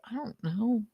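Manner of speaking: meek mocking whimper; mocking Aaron. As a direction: mumble it like a shy awkward kid. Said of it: "Aaron's like".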